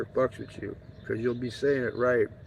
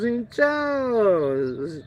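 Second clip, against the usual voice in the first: much higher put-on voice, slow and drawn out; mimicking a local guy; 9 semitones higher, much slower than usual. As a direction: play a local man greeting in a high, stretched-out voice. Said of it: "He goes".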